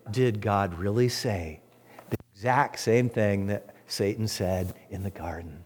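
low, slow, insinuating question; sly serpent voice. Pretend. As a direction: drawl it like the tempter in the garden. Quoting the serpent's doubt.

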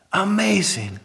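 ordinary talk, nothing marked

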